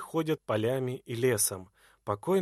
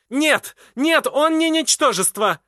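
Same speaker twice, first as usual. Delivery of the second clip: high, loud furious cry of denial; furious shout; 14 semitones higher, 12 dB louder than usual. A narrator's reading. Edvarda shouting down the forest man in fury. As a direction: scream it back at him in rage. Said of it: "кричит она".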